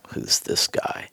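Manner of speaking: hushed, gossipy whisper; imitated whisper. Girlfriends asking who this guy is, in a whisper. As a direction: whisper it as if behind someone's back.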